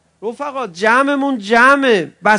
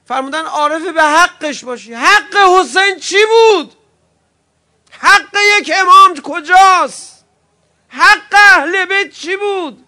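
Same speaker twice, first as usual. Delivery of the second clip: preaching at full force; 10 semitones higher, 8 dB louder than usual. Acted. Preacher to mourners, shouting the questions at full force. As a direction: hammer each question at the crowd.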